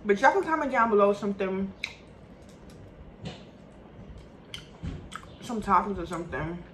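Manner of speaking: talking while eating meat; words between bites; mouth full, chewing. Real, not acted. Seen 2: Holds meat at mouth, biting and chewing throughout the words.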